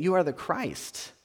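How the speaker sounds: calm steady statement; calmly. Retold or imitated. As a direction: say it calmly, without the rush. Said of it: "maybe he said it calmly".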